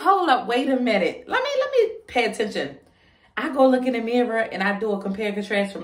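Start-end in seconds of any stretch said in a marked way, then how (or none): 0.0-2.8 higher-pitched, alarmed self-quote; acting out a startled realization; startled double take. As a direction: stop short in mock alarm, voice up. Acts out her startled reaction.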